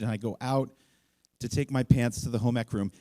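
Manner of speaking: ordinary delivery, nothing marked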